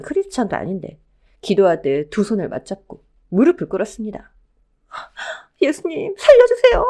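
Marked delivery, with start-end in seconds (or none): 5.6-6.8 high, loud cry for help; desperate plea. Acted. Fleeing widow kneels and begs the man she takes for Jesus, desperate plea. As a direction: beg to be saved, rising to a wail.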